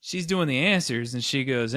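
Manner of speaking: normal talking voice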